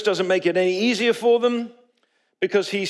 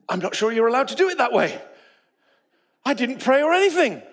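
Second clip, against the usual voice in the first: surprised; 7 semitones higher, 5 dB louder than usual. Retold or imitated. Startled reaction to sudden healing.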